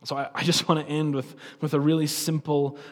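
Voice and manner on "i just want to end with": ordinary talk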